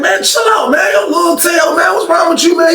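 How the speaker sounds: high shouted pleas; yelling to chill out. Retold or imitated. Cellmates shouting at the newcomer to calm down, high yell. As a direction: shout him down, telling him to chill.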